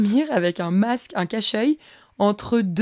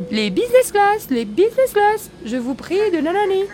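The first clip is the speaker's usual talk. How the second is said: much higher, sing-song boarding call; mock the insistent gate announcement; airport announcer; 11 semitones higher than usual. Imitating the announcer calling business class a second time. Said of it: "ils redisent genre".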